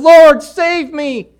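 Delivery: very high, loud, drawn-out cry; howling plea. Acted. Broken sinner crying to God, shrieked plea. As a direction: shriek it slowly to heaven, heartfelt. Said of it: "you're shrieking and you're howling".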